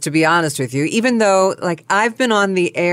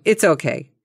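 ordinary talk, nothing marked

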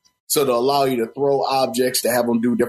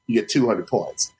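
ordinary talk

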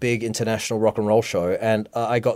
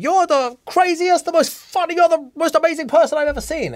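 gushing praise. Retold or imitated. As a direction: squeal it out like adoring fans. Friends gushing over dress.

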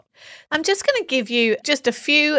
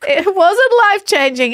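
choked up; 10 semitones higher, 8 dB louder than usual. Real, not acted. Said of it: "the tears are gonna keep coming".

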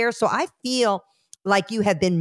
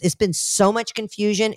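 normal talking voice

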